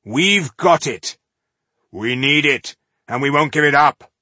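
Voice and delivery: defiant stranger. Acted. Voice in the darkness refuses.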